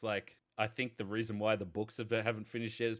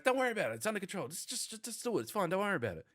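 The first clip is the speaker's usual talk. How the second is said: much higher-pitched, hurried brush-off; breezy dodgy-lawyer reassurance; 10 semitones higher, faster than usual. Acted. Fan imitating a dodgy lawyer's stock answer, high-pitched reassurance. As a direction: play a slippery lawyer waving away worries. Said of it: "But his answer to everything is like".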